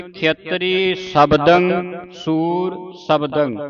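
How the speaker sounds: ਖੇਤਰੀ ਸ਼ਬਦੰ ਸੂਰ ਸ਼ਬਦੰ drawn out slowly; slow recitation. Shown as a demonstration. Teacher reading the next verse line slowly to pupils. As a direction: chant the line slowly for the class. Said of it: "ਅੱਗੇ ਪੰਕਤੀ ਆਉਂਦੀ".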